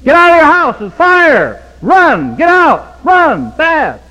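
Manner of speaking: high, loud screamed warning; retold scream. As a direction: scream at sleeping neighbors to flee.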